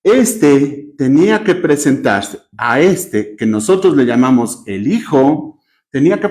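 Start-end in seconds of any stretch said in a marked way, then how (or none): none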